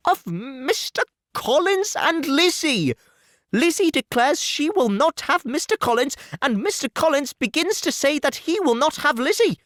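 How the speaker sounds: an anxious mother promising to bring her daughter round; Mrs Bennet, fussing. Acted. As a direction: gush reassurance at the suitor. Mrs Bennet explains to husband.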